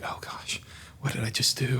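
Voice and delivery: whispered, worried self-talk; a nervous student whispering after a silent coach; panicked whisper. Retold or imitated. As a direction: whisper it like you just messed up.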